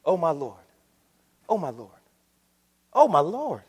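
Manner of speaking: ordinary delivery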